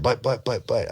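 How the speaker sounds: imitated high-pitched stammering; retold excited stuttering. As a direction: stumble over the word, bursting to get it out. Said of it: "he, all stuttering. He's so excited".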